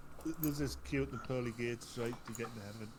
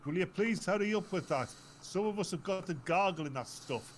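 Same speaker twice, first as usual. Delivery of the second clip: higher, louder impatient nun; 8 semitones higher, 6 dB louder than usual. Retold or imitated. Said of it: "this other nun behind her going".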